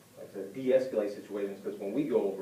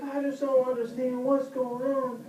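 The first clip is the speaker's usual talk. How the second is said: high, slow mock whine; hammed-up bewildered distress; distressed woman; 13 semitones higher, 4 dB louder, slower than usual. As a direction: imitate an upset driver, pitched way up. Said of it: "she was definitely in distress".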